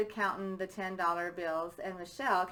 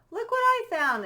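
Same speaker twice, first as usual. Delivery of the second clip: much higher and louder, bursting with discovery; excited exclamation; 13 semitones higher, 7 dB louder than usual. Acted. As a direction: shout it like you just found treasure. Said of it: "exclaimed Junior".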